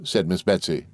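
ordinary delivery

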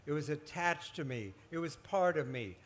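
usual speaking voice

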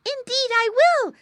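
very high, loud eager piglet; little pig brother. A narrator's reading. Little brother vows to save pig, high and eager. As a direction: promise rescue in a high piglet voice. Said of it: "said the little brother".

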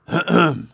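loud, drawn-out theatrical throat clearing. A narrator's reading. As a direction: clear the throat repeatedly before orating. Chancellor prepares to orate.

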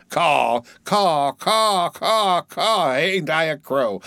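high-pitched mad crow calls; crow cawing. Acted. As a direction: caw repeatedly as a boy playing crow. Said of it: "and I'm a crow".

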